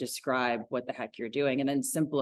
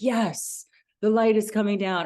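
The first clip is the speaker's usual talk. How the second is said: higher, louder acted spiritual enthusiasm; mock rapture; 7 semitones higher, 6 dB louder than usual. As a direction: play the wholly convinced believer. Acts out fully agreeing student.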